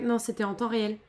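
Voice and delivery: ordinary talk, nothing marked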